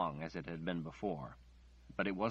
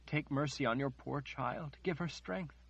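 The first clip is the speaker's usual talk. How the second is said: higher, pleading, breaking voice; anguished prayer; 6 semitones higher than usual. Acted. Margaret begs the Creator for mercy, higher and anguished. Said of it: "she cried".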